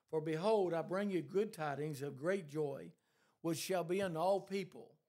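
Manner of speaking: loud, rising pulpit preaching; preaching at full force. Acted. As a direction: drive the scripture home to the congregation.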